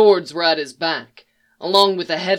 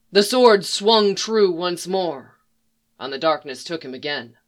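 ordinary delivery, nothing marked

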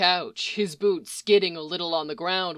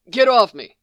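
loud shout to get off; 2 semitones higher, 8 dB louder than usual. A narrator's reading. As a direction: shove him off with your voice. Struggling, shouting at Sam.

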